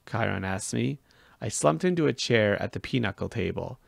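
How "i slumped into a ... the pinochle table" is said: usual speaking voice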